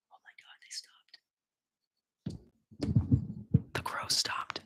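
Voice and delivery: hushed, voiceless whisper; amazed whisper. Real, not acted. Streamer to himself as the crows fall silent, amazed whisper. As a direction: whisper it in disbelief.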